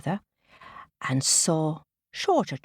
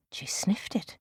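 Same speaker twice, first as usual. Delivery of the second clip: ordinary delivery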